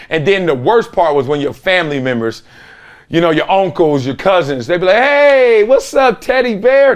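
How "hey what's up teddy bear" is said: greedy relative. Retold or imitated. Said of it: "That's what they call me".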